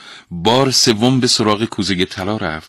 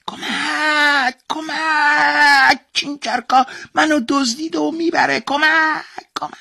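very high, stretched shrill cries for help; magic object crying for help; 5 dB louder, slower than usual. A narrator's reading. Golden object calling the demon as the boy carries it off, wailing. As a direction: wail like a tiny frightened trinket. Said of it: "صدای شیء طلایی بلند شد".

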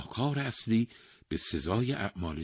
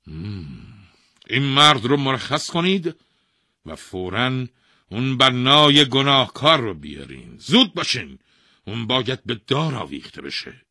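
loud, high royal orders; king ordering the builder brought to hang; commanding king; 6 semitones higher, 11 dB louder than usual. Acted. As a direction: bark orders at the guards. King orders guards after thinking.

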